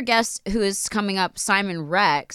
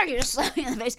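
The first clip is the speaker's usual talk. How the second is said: normal talking voice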